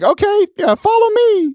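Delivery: put-on very high, eager kid voice; eight-year-old boy. Kid gamer to older friend on headset, high child voice. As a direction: imitate a little boy leading a game. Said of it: "Seth's eight-year-old brother".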